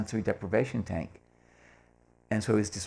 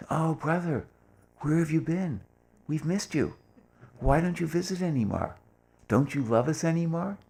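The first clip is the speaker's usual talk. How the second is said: much higher, sweet coaxing phone voice; gushing on the phone; 8 semitones higher than usual. Retold or imitated. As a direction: Erich sweetly wooing the visitor back.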